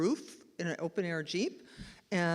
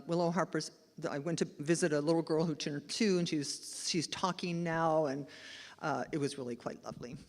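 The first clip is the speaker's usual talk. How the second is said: usual speaking voice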